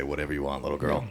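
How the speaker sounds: low, hushed, indulgent reply; quietly wrapped round her finger; soft doting dad. Retold or imitated. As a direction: give in softly to a little daughter. Dad voiced giving in to daughter.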